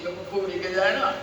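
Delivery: normal talking voice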